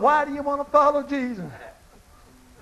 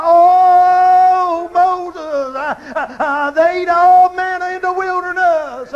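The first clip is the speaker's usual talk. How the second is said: very slow, loud, higher held oh; ecstatic cry; 4 semitones higher, 7 dB louder, slower than usual. Real, not acted. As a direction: wail the oh then drive on loud. Preacher climax cry.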